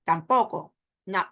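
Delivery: ordinary talk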